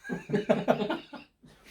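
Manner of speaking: loud, high-pitched cackle; high peals of laughter. Real, not acted. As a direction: burst out laughing. Cracking up over rotund rabbit.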